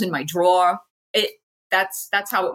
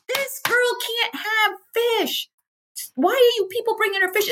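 angry nurse. Retold or imitated. Nurse scolding staff over fish, high indignant voice. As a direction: scold them in a high pitched voice. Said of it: "She's like".

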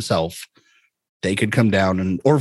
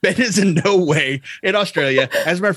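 high, laugh-filled admission; 8 semitones higher than usual. Real, not acted. Host admitting his friend isn't in Australia, laughing. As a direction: talk through a real laugh.

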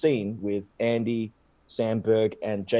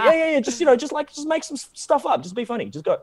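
high-pitched, hurried-along crew brush-off; breezy urging; 11 semitones higher, faster than usual. Retold or imitated. Film crew telling the actor to improvise, breezy urging. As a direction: wave away his worry in a higher voice.

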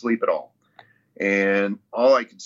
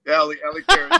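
laughing; 7 semitones higher, 7 dB louder, faster than usual. Real, not acted. Joking about coach's nickname.

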